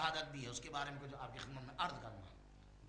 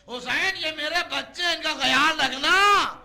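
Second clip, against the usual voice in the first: loud, high cry; anguished plea; 12 semitones higher, 18 dB louder than usual. Acted. Dying man entrusts his children to his brother, loud anguished plea. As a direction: wail the last request to a brother.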